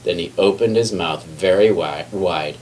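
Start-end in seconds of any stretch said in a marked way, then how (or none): none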